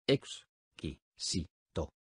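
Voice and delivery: "Ex. Qui. Si. To." each syllable spoken apart with a pause. Shown as a demonstration. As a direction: split "Exquisito" into separate syllables. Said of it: "dila silábicamente conmigo". (separando sílabas: "Ex. Qui. Si. To.")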